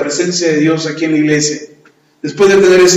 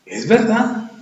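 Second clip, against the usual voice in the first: Es verdad lifted higher; raised, firmer agreement; higher repeat; 6 semitones higher, 3 dB quieter, slower than usual. Shown as a demonstration. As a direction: repeat it with a lift.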